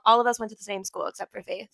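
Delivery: ordinary talk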